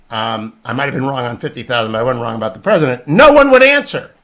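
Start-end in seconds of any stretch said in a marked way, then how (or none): 3.1-4.1 much higher and louder, hammering NO; indignant outburst. Real, not acted. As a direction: blast out No in disbelief. Host indignant nobody answered.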